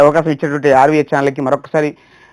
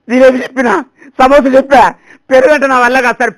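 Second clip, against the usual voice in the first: higher, louder pleading; exasperated waiter; 6 semitones higher, 4 dB louder than usual. Retold or imitated. Waiter giving up explaining curd, higher and louder pleading. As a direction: plead in frustration. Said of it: "పనిచేసే అతను".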